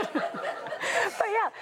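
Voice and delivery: high bubbling laugh. Real, not acted. Laughing at her own joke about the book title. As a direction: laugh it off into the next words.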